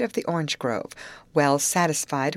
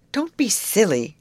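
a light scolding, a bit louder; chiding; 4 semitones higher, 5 dB louder than usual. A narrator's reading. Grace chides Molly for her alligator joke, scolding. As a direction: tell her off briskly for being silly. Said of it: "chided Grace".

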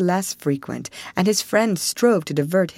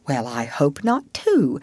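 ordinary delivery, nothing marked

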